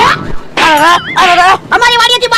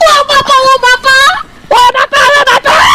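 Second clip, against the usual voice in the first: fake pain cries; 5 semitones higher, much slower than usual. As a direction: pretend the blows hurt, wailing over and over. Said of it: "તું ખોટું ખોટું હોય બાપા એમ બોલજે". (ખોટેખોટા ઊંચા, જોરથી નાટકીય બરાડા)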